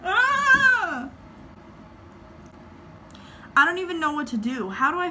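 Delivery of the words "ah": high panicked scream. Real, not acted. Vlogger screaming at her ruined eyebrows. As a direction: let out a shrill yelp of panic. Panic after bad wax.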